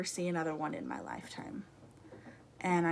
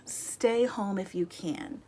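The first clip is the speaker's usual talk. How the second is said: ordinary talk, nothing marked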